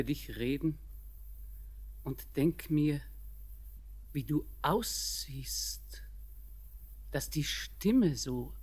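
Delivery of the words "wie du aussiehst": soft unvoiced whisper; whispered wonder. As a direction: breathe the words. Grandmother musing on his voice.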